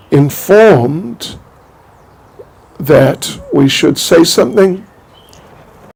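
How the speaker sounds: ordinary talk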